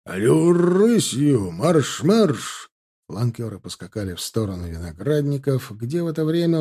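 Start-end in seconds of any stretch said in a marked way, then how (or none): 0.0-2.7 high, drawn-out marching call; shouted command. A narrator's reading. Salov sending the troop off at a trot, shouted command. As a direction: stretch the first words, then drive them on. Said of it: "долетела команда Салова".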